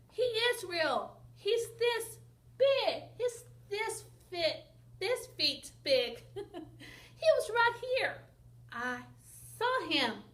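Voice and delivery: high, loud and slow insisting; insistent. Acted. Ben insists to the doubting people, high and pleading. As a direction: plead that he really saw it.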